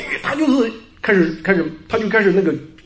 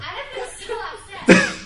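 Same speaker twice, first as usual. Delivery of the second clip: real high-pitched laugh. Real, not acted. Storyteller laughing at being left stranded, high laugh.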